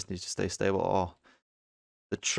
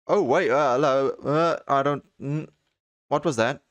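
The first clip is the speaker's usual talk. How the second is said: startled; 3 semitones higher, 6 dB louder than usual. Real, not acted. Startled by something while exploring.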